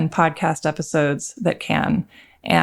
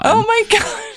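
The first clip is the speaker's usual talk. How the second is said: shocked. Real, not acted. Reacting to cut cable.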